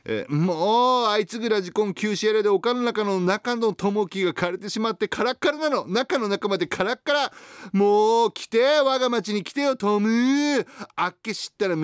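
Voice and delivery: high, loud, gushing mom character; excited high letter voice. Acted. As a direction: read it as a giddy, pleading mom. Reads letter in mother persona. (興奮した高い声のおかんキャラ)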